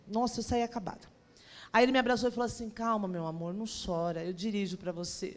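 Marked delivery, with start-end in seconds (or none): 2.7-5.3 low, soft reassuring voice; tender, hushed retold reassurance; soothing comfort. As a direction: comfort them gently, voice dropped. Husband comforting her, retold.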